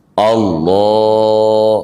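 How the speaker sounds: Allah with the lam held long. Shown as a demonstration. Teacher demonstrating the lengthened lam. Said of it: "Ngemanjangin lam boleh?".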